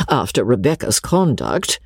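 usual speaking voice

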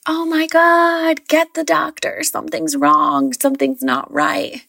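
high-pitched panicked cry for help; retold yelling. Mother in labour calling for the doctor, retold yelling. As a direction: call out in alarm, pitch jumping high, first words stretched out. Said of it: "you yelling out".

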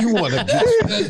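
high, slow, laughing infomercial parody. Retold or imitated. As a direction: ham up a smooth TV pitchman. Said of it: "that infomercial that was selling them R and B compilations".

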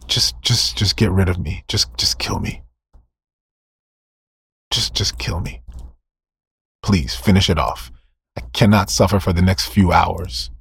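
faint whispered begging, barely voiced; dying man whispering to be finished off; whispered plea. Preacher voices dying Saul's plea.

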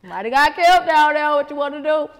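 high, loud teasing call of Nosy Rosie; mock neighbor calling out. Kids calling out to nosy grandma, loud and high.

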